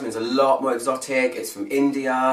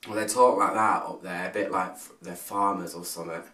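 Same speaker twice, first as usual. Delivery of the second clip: put-on low, quieter, farmer-like northern accent; bad northern accent; 5 semitones lower, 4 dB quieter than usual. Reviewer teasing how friends up north talk, bad northern accent. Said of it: "That's probably the worst accent ever".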